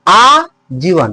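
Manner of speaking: आजीवन very slow, opening आ drawn out; stress on first syllable. Shown as a demonstration. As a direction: hold the आ of आजीवन long, then say जीवन. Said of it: "आप बल लग रहा है". (आजीवन के आ को खींचकर बल)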